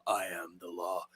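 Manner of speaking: very low, hushed menace; deep, deadpan lawman line; Dredd's voice. Acted. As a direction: drop to a deep, quiet threat. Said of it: "when he drops the line".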